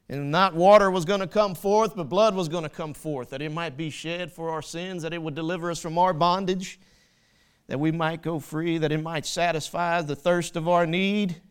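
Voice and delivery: loud, rising sermon voice; preaching at full force. Acted. Preacher to congregation, loud full-force preaching. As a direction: drive it home to the congregation.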